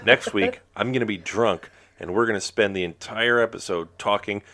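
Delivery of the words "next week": excited. Acted. Hammed-up host announcement.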